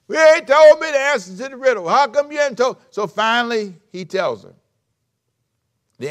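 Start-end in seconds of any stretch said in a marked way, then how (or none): 0.1-2.8 high, loud whining plea; whiny wife. Retold or imitated. Wife nagging Samson for the riddle answer, high whining. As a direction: wheedle like a tearful wife pestering her husband.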